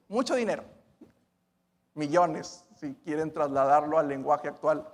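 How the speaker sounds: normal talking voice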